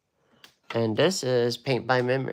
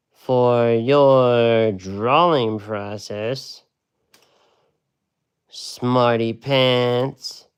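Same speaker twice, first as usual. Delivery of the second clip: playful, drawn-out, louder mock scolding; teasing comeback; 3 semitones higher, 6 dB louder, slower than usual. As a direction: tease her like a know-it-all caught out. Retold comeback to a student.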